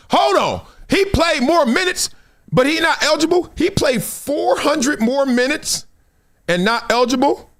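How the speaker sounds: can't believe the numbers; incredulous. Real, not acted.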